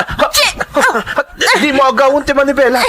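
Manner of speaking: don't grab the wheel, we'll crash; panicked yelling. Acted. Driver panics as car swerves.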